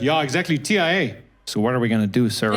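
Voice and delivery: put-on American accent slipping through; lightly mocked American film voice; Leonardo DiCaprio. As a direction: do a film hero dropping into American. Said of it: "when he whispered in that film, he just went straight back to being American".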